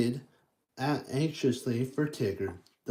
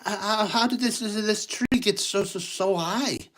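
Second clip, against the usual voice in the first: higher, stammering worry; worried voice; 6 semitones higher than usual. Acted. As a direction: stutter as the fear of heights sets in. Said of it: "he asked in a worried voice".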